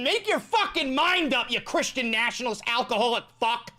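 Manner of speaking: high, indignant tirade; exasperated rant. Real, not acted. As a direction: berate him in worked-up disbelief. Recorded rant at Pete Hegseth.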